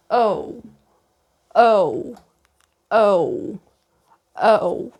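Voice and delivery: "O" drawn out alone, carefully modelled; vowel demo. Shown as a demonstration. Learner showing viewers the vowel, demonstrating "O" clearly. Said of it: "So this one is".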